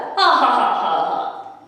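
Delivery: loud shared laugh; Theodore laughing. A narrator's reading. Theodore laughs along with the boy, hearty laugh. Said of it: "that made Theodore laugh".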